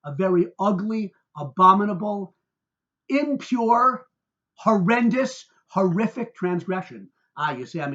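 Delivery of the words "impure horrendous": ordinary talk, nothing marked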